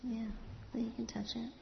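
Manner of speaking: soft whispered encouragement. Real, not acted. Inviting a child to touch worms.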